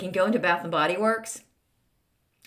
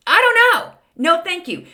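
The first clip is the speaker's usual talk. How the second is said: exasperated; 11 semitones higher, 8 dB louder than usual. Real, not acted. Mock-horrified rant about the scent.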